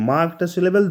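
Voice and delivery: normal talking voice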